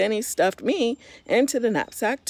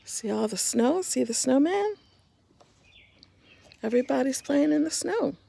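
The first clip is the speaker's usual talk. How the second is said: bright, higher voice showing a picture book; gentle higher picture-book aside; 7 semitones higher, 3 dB quieter than usual. Real, not acted. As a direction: point at the page for the little ones. Reader talks to children, pointing.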